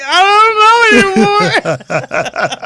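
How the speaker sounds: very high, loud, drawn-out comic bawling; mock tearful wail. Daughter in tears at the order window, mock tearful wail. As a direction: wail it slowly, hammed-up tears. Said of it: "I was in tears in the line".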